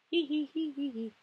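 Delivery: high, soft hee-hee giggle; gleeful giggle. Real, not acted. Vlogger on vacation for a week, gleeful giggle.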